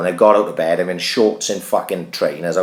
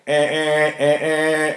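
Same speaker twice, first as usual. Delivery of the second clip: high nasal-free mock horn honks, eh eh; car horn beeps; 8 semitones higher, much slower than usual. Neighbour mimicking the honking car outside, mock beeps. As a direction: imitate the horn blaring in short bursts.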